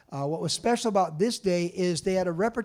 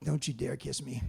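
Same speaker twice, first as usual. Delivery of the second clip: low warning; 5 semitones lower, 7 dB quieter than usual. Retold or imitated. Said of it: "he's like".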